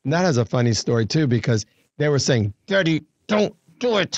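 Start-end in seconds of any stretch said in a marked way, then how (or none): 2.7-4.2 lightly voiced pleading children, pitched higher; pleading kids. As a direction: imitate kids begging their dad.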